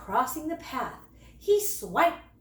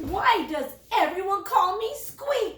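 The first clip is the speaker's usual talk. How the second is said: a higher, louder grumpy little cub; a cub sulking at his brothers, higher; grumpy question; 7 semitones higher, 5 dB louder than usual. A narrator's reading. Little cub grumpily asking his brothers why. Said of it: "he asked his brothers grumpily".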